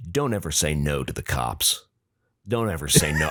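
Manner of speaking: low-pitched imitation of a lecturing officer; mock low authority voice; stern cop. Retells cop's stern parting advice.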